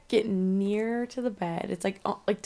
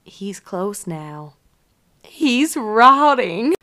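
slow creepy whisper that swells loud; creepy little girl; 8 dB louder, much slower than usual. Retold or imitated. Retelling the horror movie's creepy girl, slow and eerie. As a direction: drag it out, eerie and menacing. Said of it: "creepy demonic smirk".

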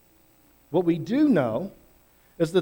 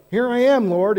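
eager answer; 6 semitones higher, 6 dB louder than usual. Pretend.

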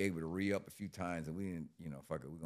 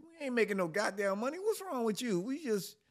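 much higher, outraged disbelief; incredulous yell; 5 dB louder than usual. Retold or imitated. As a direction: yell at her like she's lost it. Arguing with girlfriend, retold.

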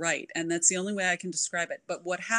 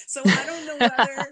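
laughing; 8 semitones higher, 7 dB louder than usual. Real, not acted. Laughing mid-sentence.

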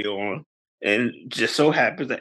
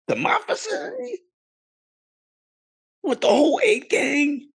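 high-pitched indignant protest; comic indignation, pitched way up; mock outrage; 13 semitones higher than usual. Boyfriend scolding over guests at his place, mock outrage.

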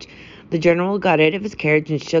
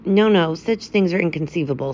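ordinary delivery

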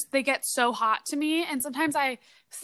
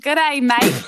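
loud, hammed-up Australian accent greeting; bad Australian accent, played for laughs; 8 dB louder, slower than usual. A host tries an Australian accent on her co-host. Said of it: "Australian accent".

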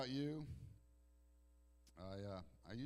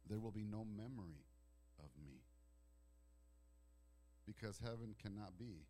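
ordinary talk

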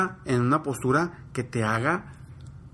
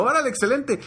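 proud praise; 9 semitones higher than usual. Retold or imitated. Father praising son's confidence.

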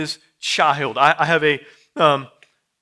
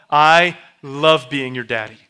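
louder, eager declaration to a little son; a father beaming at his toddler before timeout; excited daddy; 9 dB louder than usual. Retold or imitated. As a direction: burst out with joy at your boy. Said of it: "I tell my kids".

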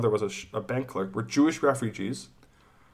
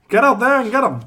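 mock coach shout; 11 semitones higher, 9 dB louder than usual. Mock coach pep talk.

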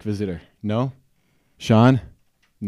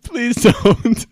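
much higher, pleading whine; whimpering plea; 12 semitones higher, 3 dB louder than usual. Acted. Host begging the ogre not to devour him, high whimpering plea. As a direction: beg him not to eat you. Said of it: "Stop crying".

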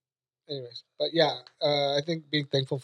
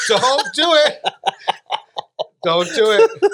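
loud, high plea through laughter; helpless laughing warning; laughing protest; 8 semitones higher, 9 dB louder, slower than usual. Real, not acted. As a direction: beg them to stop while cracking up. Laughingly begging friend not to joke.

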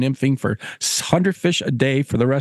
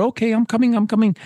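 higher-pitched, harried reply; hurried angler; 7 semitones higher, faster than usual. Acted. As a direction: answer a pressing guide as you follow. Angler answering the urging gillie.

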